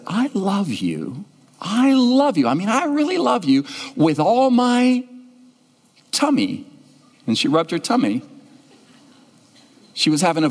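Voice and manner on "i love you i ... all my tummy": put-on high, earnest child declaring love; little girl voice. Little girl telling the pastor she loves him, high and earnest. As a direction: say it like a four-year-old meaning every word. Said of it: "she said".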